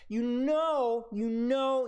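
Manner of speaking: KNOW drawn out long; exasperated emphasis. Real, not acted. Coach scolding a player out of position, exasperated KNOW drawn out. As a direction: stretch each KNOW in exasperation.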